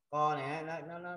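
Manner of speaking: ordinary talk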